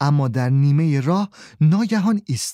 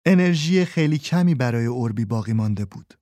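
usual speaking voice

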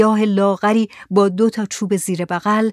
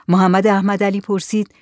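usual speaking voice

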